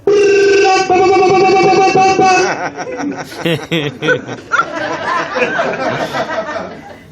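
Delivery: long high cackling laugh; big laugh. Real, not acted. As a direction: burst out laughing at your own joke. Laughing at own bit.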